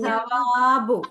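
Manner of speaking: സവാബു very slow, long വാ; drawn-out syllables. Shown as a demonstration. Teacher models the word for the class, drawn-out syllables. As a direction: stretch the വാ in സവാബു. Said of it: "നമ്മൾ വാ".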